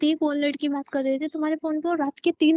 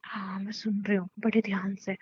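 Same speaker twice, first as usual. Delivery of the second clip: low, quiet, pointed reply; subdued, pointed attention; icy restraint; 5 semitones lower, 7 dB quieter than usual. Acted. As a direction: hold the anger down, soft and low. Wife icily agrees to listen.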